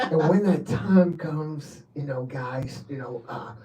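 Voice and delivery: slow, soft build with hesitations; mock-solemn telling. Station manager telling the guys of the time to come, slow and hushed. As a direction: draw it out softly, like a solemn promise. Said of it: "Why are you saying it like that?".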